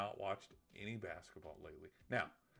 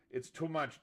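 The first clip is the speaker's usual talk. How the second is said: Edna Mode; 6 semitones higher, 6 dB louder than usual. Retold or imitated. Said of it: "to quote Edna Mode".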